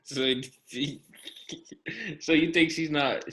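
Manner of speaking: real giggles breaking up the question; trying not to laugh. Real, not acted. Co-host asking a question he can't finish, laughing. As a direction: fight back laughter while asking. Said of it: "can't say it without laughing".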